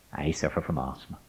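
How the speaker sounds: low, soft admission; vulnerable confession. Retold or imitated. Student admitting what lies behind his aggression, quiet confession. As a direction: let it out quietly, well below the bluster. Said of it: "can lie something like".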